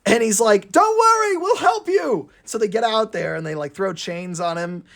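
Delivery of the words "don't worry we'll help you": high, loud reassuring call. Acted. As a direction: shout down to reassure a friend. Thomas calling to Booster.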